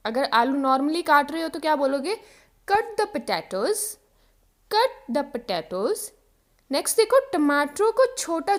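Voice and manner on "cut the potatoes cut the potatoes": slow model sentence. Shown as a demonstration. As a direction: cut the potatoes said very slowly. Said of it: "examples देखो". (धीरे-धीरे बोलें)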